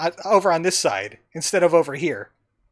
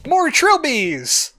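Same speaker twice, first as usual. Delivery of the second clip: drawn out, higher and louder in fake wonder; a slow, hammed-up cry of delight; mock thrilled exclamation; 8 semitones higher, 5 dB louder, much slower than usual. Real, not acted. Player reacting to a room of Trilbies with mock glee. Player sees many copies.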